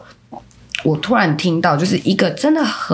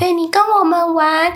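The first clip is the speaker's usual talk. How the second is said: put-on high little kid calling big sister; high, slow, childlike pleading; child's voice; 12 semitones higher, slower than usual. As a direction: coax her to get up and play. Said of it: "那小朋友就说".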